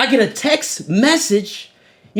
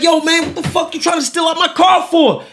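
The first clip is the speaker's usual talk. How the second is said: mock furious yell, a bit higher; retold angry shout; 6 semitones higher than usual. Retelling confronting the man at his car, mock angry shout. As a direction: demand it like you caught a thief. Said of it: "I'm like".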